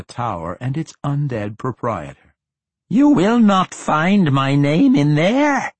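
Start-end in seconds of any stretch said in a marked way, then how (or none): 2.9-5.7 high, slow, keening lich voice; like a note about to crack. A narrator's reading. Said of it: "came a high-pitched voice that seemed on the verge of keening".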